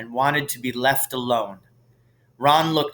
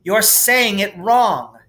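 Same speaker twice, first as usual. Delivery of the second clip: sharp, higher, louder correction; snapping; 7 semitones higher, 5 dB louder, slower than usual. A narrator's reading. Hermione snapping at Ron over the spell. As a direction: snap a know-it-all correction. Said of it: "Hermione snap".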